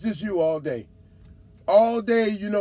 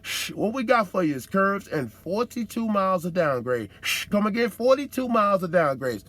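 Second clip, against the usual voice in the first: mock road sign voice. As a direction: play the warning sign like a smooth hustler. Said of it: "they hit you with a sign and say".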